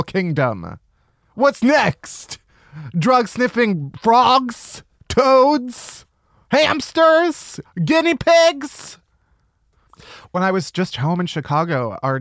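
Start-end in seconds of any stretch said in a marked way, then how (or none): 1.4-9.0 escalating disbelief. Acted. Escalating absurd list of narc animals.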